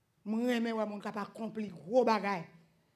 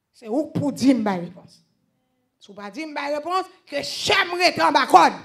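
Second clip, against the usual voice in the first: loud preaching burst; rising sermon shout; preaching at full force; 4 semitones higher, 12 dB louder than usual. Acted. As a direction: drive the point home from the pulpit.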